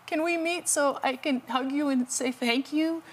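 lightly voiced weak old man; high, thin grateful caller. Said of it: "this weak voice".

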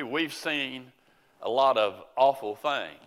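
ordinary delivery

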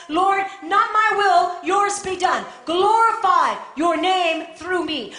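loud, high preacher's plea; fervent prayer. Acted. Preacher showing the church how to pray to the Lord, fervent prayer. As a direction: pray it out with full pulpit force.

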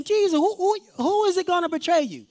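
high, urgent blurted question; open, direct asking. Acted. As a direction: ask it out loud for the whole room, pitched high. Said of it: "Instead of just saying".

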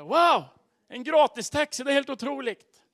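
high, loud, wowed outburst; amazed delight. Retold or imitated. Passenger thrilled at a free taxi ride, amazed delight. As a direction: exclaim it in thrilled disbelief.

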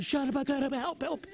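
high, frantic mock prayer; imitated high-pitched pleading; mock desperate supplication. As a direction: cry out as if praying for rescue, hammed-up. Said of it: "crying out in supplication".

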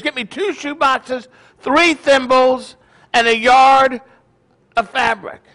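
high, deliberate listing, word by word; insistent command. Acted. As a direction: play a father insisting on each item. Joke father insists on his order.

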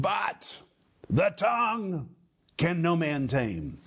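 weighty pauses after "But" and "tongue"; emphatic. Retold or imitated. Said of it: "It is very emphatic. It's very categorical".

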